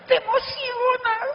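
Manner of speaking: high, stretched, thrilled exclamation; excited cry. Acted. The speaker telling the audience how thrilling sponsoring feels, high and excited.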